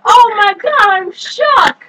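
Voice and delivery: loud, slow, hammed-up shock; mock outrage. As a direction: overact amazed disbelief, shouting. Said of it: "did I make the stream more entertaining".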